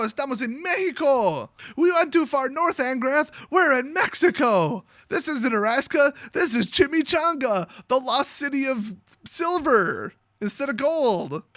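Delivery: high, over-dramatic mock alarm; hammed-up outburst. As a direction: declaim it high, stretching the big names. Acts out Huatli's overblown alarmed outburst.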